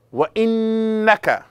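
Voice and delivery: وَإِنَّكَ with the doubled noon held long and high; récitation appuyée. Shown as a demonstration. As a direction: stretch the nn of وَإِنَّكَ, pitched high. Teacher recites with stretched nasal hold.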